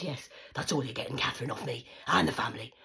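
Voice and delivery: loud, cold final verdict; mother telling her daughter that's all she gets, loud; mum yelling. Retold or imitated. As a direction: lay down the law, loudly. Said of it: "So she says".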